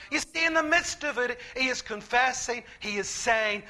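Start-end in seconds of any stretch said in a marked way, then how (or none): none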